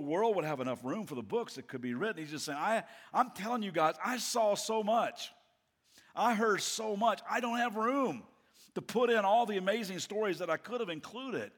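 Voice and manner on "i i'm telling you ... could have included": higher-pitched, emphatic insistence; excited testimony. Acted. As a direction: tell it like an amazed eyewitness. Acting John's astonished testimony.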